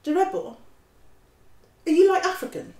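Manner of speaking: high-pitched, curious street youth; young client. Acted. Voices client questioning lawyer.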